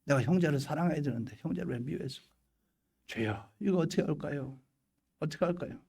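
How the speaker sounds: soft, subdued self-reproach; acted troubled prayer. Believer asking the Lord why they hated a brother, hushed regret. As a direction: pray softly, at a loss what to do.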